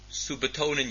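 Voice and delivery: ordinary delivery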